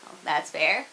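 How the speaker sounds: usual speaking voice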